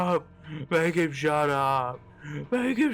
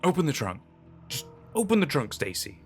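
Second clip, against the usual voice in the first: insistent, raised appeal; beg, pressing harder on the repeat; pleading; 3 semitones higher than usual. A narrator's reading. Hutch begging Stacy to open the trunk, pleading. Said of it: "Hutch said pleadingly".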